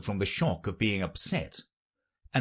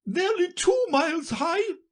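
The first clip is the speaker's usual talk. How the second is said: piercingly high queen's cry; very high, forceful royal voice; imperious queen; 3 dB louder than usual. Acted. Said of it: "added the queen".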